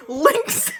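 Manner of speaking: plain reading voice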